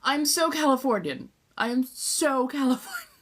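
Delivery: playful Southern California lilt; California accent. Real, not acted. Streamer laughing at her own California accent. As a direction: lean into the Californian valley sound. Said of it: "goes straight back to the California accent".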